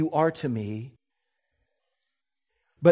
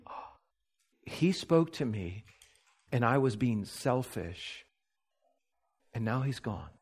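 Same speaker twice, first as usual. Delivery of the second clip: voicing her regret; 4 semitones lower, 7 dB quieter than usual. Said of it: "And she's saying".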